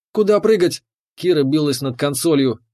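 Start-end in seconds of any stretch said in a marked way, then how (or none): 0.1-0.8 high, urgent alarmed question; a touch of panic, pitched well up; frantic. A narrator's reading. Kira at the console under fire, frantic and high.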